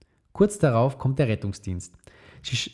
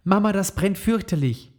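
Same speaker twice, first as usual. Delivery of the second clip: high-pitched complaining call; a hurt boy protesting the sting; 9 semitones higher than usual. Acted. Max calls to Mama while his wound is dabbed, high and complaining. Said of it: "Max ruft laut".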